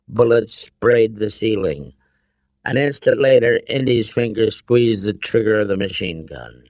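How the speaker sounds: usual speaking voice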